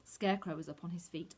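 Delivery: usual speaking voice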